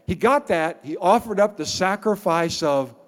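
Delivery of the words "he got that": ordinary delivery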